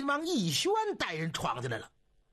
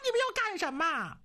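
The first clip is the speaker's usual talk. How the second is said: storyteller's mock shriek of alarm; startled yelp; 12 semitones higher, faster than usual. A narrator's reading. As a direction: yelp in high-pitched protest. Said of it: "当时嗷嗷就一嗓子".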